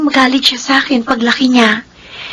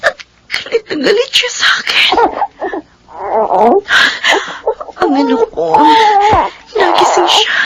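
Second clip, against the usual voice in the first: tearful; 7 semitones higher than usual. Acted. Tearful mother after nightmare.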